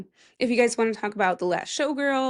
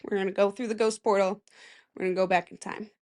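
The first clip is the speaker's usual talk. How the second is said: ordinary talk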